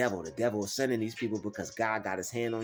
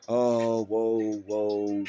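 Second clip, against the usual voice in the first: mock alarmed whoas; 4 dB louder, much slower than usual. Mocking a discouraged person's gasp.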